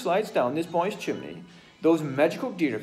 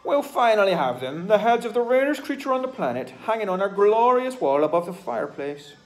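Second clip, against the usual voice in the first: much higher, much louder gloating; triumphant villain; 7 semitones higher, 12 dB louder than usual. Acted. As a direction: crow over the trophies. Hunter triumphant.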